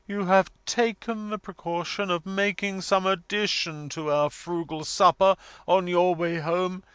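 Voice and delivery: high, loud, stately declamation; solemn, grand matron. A narrator's reading. Missus Wilfer on the frugal supper, solemn grandeur. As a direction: pronounce it like a martyred grande dame. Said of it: "being solemnly turned upon".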